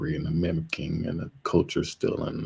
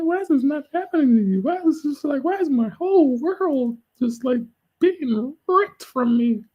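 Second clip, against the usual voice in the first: much higher, loud, mocking whine; envious whiner; 4 dB louder than usual. Envious people asking why it didn't happen to you, high mocking whine. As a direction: mimic the envious crowd in a shrill put-on voice. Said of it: "people who are gonna be envious".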